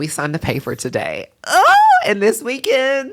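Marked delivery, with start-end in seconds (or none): 1.5-3.1 much higher and louder, laughing with glee; excited. Real, not acted. Telling her friend about the big weekend, giddy and squealing.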